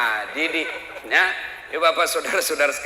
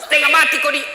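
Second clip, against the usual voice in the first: loud, higher scolding of a husband; angry wife; 11 semitones higher, 9 dB louder, faster than usual. Retold or imitated. Wife scolding the camat husband over a phone, angry yell. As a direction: scold him hard for being stingy. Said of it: "Marah mamanya".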